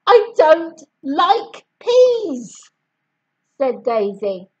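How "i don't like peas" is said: slow, stubborn and firm; stubborn refusal. A narrator's reading. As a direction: dig in your heels.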